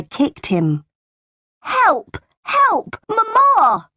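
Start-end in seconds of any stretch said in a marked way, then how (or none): 1.6-3.9 high, wailing cries for mother; shouting for help. A narrator's reading. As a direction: yell in alarm like a boy under attack. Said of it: "John shouted".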